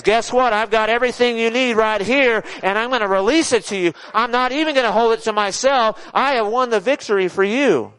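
high, forceful victory proclamation; triumphant Jesus. Acted. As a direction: announce it exultantly, driving upward. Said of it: "And he says".

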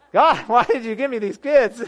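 high, loud mock plea through laughter; comically despairing, laughing wail; laughing exasperation. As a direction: cry it out to heaven, cracking up. Joking second take, laughing.

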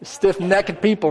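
"necked" as two syllables, NECK-ed, in a country twang. Real, not acted. Preacher sticking with his favored word, country twang. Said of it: "That little accent, country twang".

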